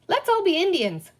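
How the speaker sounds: high, loud eager pirate voice; a pirate mate suggesting a game, eager. A narrator's reading. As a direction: pitch the idea with boyish glee. Smee voiced in story.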